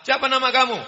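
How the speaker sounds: high, loud, fast questioning examiner; stern examiner. Acted. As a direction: grill the young man from on high. Examiner questions prospective son-in-law.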